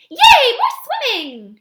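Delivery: high, loud and drawn-out squeal of delight; little elephant crying out with joy; excited cry. A narrator's reading. Ruby begging to go swimming, a high, slow, loud delighted cry. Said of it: "Ruby cries".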